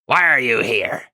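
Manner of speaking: mildly barked king's demand; a touch of a gruff royal bark. A narrator's reading. King Nim questions visiting knights from his throne, mild bark. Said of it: "he, he barks".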